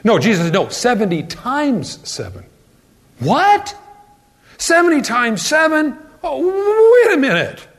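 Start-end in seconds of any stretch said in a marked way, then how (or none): 3.2-6.0 high, astonished What? Seventy times seven?; incredulous disbelief. Acted. Peter's astonished reply to Jesus. 6.2-7.7 mock realization. Disciple realizing the catch.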